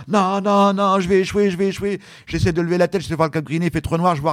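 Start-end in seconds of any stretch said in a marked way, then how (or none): 0.1-2.0 higher, panicky mock despair; panicked thoughts. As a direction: fear of running aground. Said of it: "moi je suis là".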